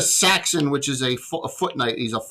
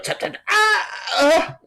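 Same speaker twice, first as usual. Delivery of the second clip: pained cry; 13 semitones higher, slower than usual. Acted. Jaws biting, character cries out.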